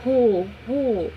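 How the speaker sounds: ro said alone and repeated; ro, ro as a clear separate syllable; initial syllable isolated. Shown as a demonstration. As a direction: isolate ro, the first syllable of rolha. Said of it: "qual é o som inicial".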